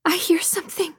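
a nervous woman at a campfire; uneasy woman. Acted. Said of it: "one of the women said".

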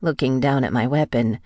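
plain reading voice

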